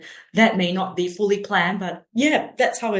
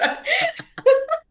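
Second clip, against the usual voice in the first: high burst of laughter; 3 dB louder than usual. Real, not acted. Laughs at own anecdote.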